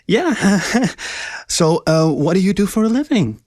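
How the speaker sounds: high, giggly, nervous. Acted. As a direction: fake nonchalance, high pitched. Nervous girl forcing nonchalance.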